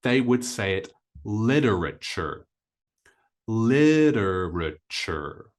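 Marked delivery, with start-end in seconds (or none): 1.2-5.5 literature as LID-er-uh-cher, R sounded; American pronunciation. Shown as a demonstration. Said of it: "in the U S A".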